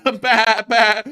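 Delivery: goofy farmyard noises for the viewers; animal noises. Pretend. Host acts out a viewer's animal noises, high silly bleats. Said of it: "me doing the animal noises".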